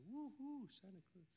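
high, soft mock woo-hoo that tails off; mock cheer. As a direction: pretend hometown whoop, kept small. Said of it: "jump up and down and say".

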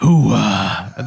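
low, drawn-out catchphrase imitation; mock film-star exclamation; Pacino. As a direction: draw out "hooah" like the movie star. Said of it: "That was Pacino, wasn't it".

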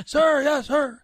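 loud high sir yes sir; recruit shouting back. Retold or imitated. Recruit answering first sergeant.

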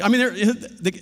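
ordinary delivery

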